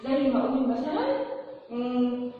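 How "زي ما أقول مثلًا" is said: ordinary talk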